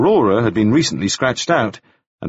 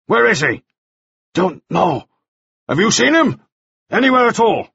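pressing Mannering; 11 semitones higher, faster than usual. A narrator's reading. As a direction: press him for an answer.

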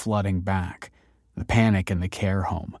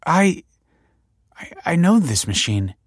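halting, higher-pitched stammer; 9 semitones higher, 4 dB louder than usual. A narrator's reading. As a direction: stumble over the first word, shaken. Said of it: "she stammered".

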